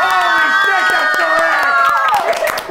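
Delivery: high, slow, elated shout; ecstatic cheer. Real, not acted. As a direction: cheer the win at full pitch.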